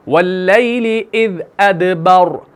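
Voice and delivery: अदबर with a thick, full-mouthed r and no final vowel; heavy r at the stop. Shown as a demonstration. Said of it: "मैंने इसको मोटा कर दिया".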